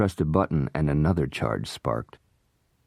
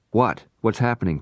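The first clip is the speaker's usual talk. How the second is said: alarmed question; 6 semitones higher than usual. Acted. Noah alarmed below.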